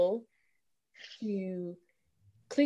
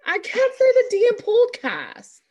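loud, high mock English accent; exaggerated high English-accented protest; put-on English accent; 11 semitones higher, 5 dB louder than usual. Host insisting she can't say the D, in loud mock English accent. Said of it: "throw the whole accent off".

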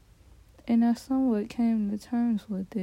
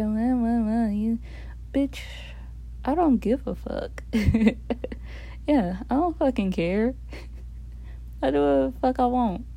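cocky swagger. Pretend. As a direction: boast like you're untouchable. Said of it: "that's the Tashawn Versace coming back".

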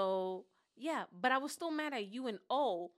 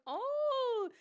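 high, drawn-out mock awe; exaggerated high-pitched amazement; mock impressed reaction; 13 semitones higher, 4 dB louder than usual. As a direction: coo as if very impressed. Group's impressed reaction retold.

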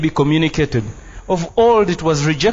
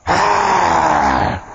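mock shouting preacher; much slower than usual. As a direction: belt it out slowly, parodying a sweaty revivalist. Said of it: "spits and shouts and sweats".

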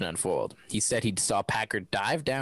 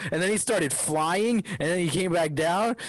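excited; 6 semitones higher than usual. Real, not acted. Mocking exaggerated retelling.

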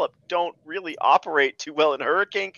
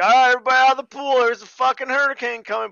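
higher, louder, very fast hammed-up call; mock announcement; 7 semitones higher, 6 dB louder, faster than usual. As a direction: rattle it off like a lifeguard shouting. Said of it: "lady's like".

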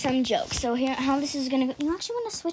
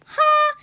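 mock laugh; 7 dB louder than usual. Fake laugh after joke.

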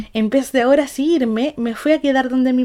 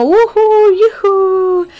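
mock cheering; 7 semitones higher, 5 dB louder than usual. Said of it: "Y yo como".

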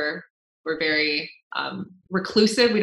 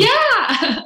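excited yeah; 12 semitones higher, 5 dB louder than usual. Real, not acted. Excited reaction to American Idol news.

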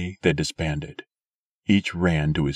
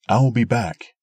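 raised voice, calling across; 6 semitones higher, 5 dB louder than usual. A narrator's reading. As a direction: call it out loud. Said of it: "he called to Light".

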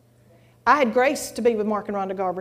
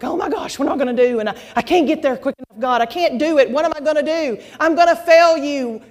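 mock frantic pleading, building louder; acted panic; 3 semitones higher, 5 dB louder than usual. Woman fretting to God about failing, acted panic. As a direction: fret out loud, rising into desperation. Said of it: "trying to get there and like".

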